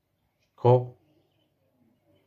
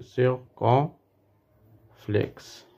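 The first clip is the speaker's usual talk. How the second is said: "circonflexe" very slowly, broken into syllables with long pauses; 3 semitones higher, much slower than usual. Shown as a demonstration. Teacher dictating the name of the accent, syllable by syllable. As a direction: draw out "circonflexe" piece by piece, pausing before the end.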